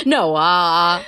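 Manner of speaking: "Noah" called out loud with a long, drawn-out "ah"; "Noah" as a big, comic "No-aaah"; exaggerated name pronunciation. Shown as a demonstration. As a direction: stretch the end of "Noah" in a loud mock wail. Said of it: "supposed to say it like that".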